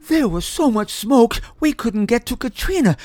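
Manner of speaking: high, loud frantic cry; panicked scream. A narrator's reading. Mother panicking about the baby trapped in fire. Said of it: "screamed the panicked Missus Smith".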